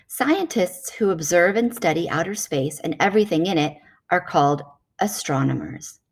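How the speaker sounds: normal talking voice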